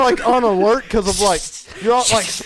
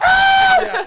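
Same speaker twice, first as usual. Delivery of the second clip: much higher, loud, laughing scream; 4 dB louder than usual. Shown as a demonstration. Showing friends how he screamed, a laughing scream. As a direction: rebuild the scream through laughter. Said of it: "I scream at the top of my lungs".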